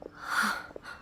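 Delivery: quick breathy intake of surprise; sharp unvoiced gasp. Shogun startled at seeing an old friend, sharp gasp. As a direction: gasp as if caught off guard. Sees light, then Furuyama.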